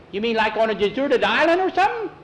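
put-on high, loud boyish question climbing upward; alarmed question. A narrator's reading. Boy asks about being put ashore, alarmed question.

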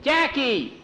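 high-pitched holler; calling out. A narrator's reading. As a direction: call a teammate across the court. Player calls teammate for pass.

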